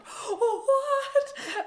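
very high, loud, cracking "What?" with a laugh; shocked "what". Retold or imitated. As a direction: yelp it in delighted disbelief. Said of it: "And he was like".

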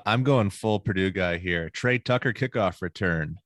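usual speaking voice

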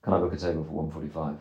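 low, quiet, flat phone booking; flat, unfriendly. Retold or imitated. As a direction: imitate someone booking curtly, low and toneless. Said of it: "You were quite flat".

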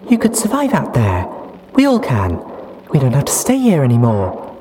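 put-on high, loud, giddy young mare. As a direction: chatter happily about the future. Duct Tape excited.

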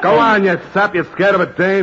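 loud, high, exasperated goading; angry boss jeering at a coward; scornful order. Acted. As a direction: shame your henchman into acting. Boss berates cowed henchman.

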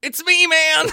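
shrieking wife; high desperate cry. Acted. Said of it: "His wife cried".

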